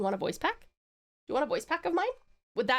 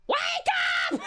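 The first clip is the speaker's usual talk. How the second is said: wake-up yell. Shown as a demonstration. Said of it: "I would be like".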